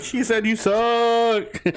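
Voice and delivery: hammed-up gleeful translator; mock emphatic translation. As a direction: savor each word of the insult. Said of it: "And then D J's like".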